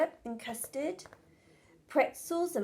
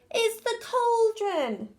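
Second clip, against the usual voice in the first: high, slow, alarmed cry of "cauldron"; panicked cry; 10 semitones higher, 3 dB louder, slower than usual. Acted. Betty crying to Mum about the overflowing cauldron. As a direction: wail it for help. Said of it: "cried Betty".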